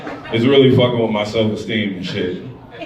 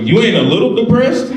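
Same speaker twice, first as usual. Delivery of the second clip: mock incredulous question; 11 semitones higher than usual. Mocking question to bubbly partner.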